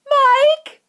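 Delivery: very high, loud frantic cry; mother calling out in alarm; cried out. A narrator's reading. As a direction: scream her son's name in panic. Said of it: "cried Missus Teavee".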